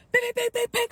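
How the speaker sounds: high loud bing-bing punch noises; mock punch sounds. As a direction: fire off the punches with your voice. Imitating punches again.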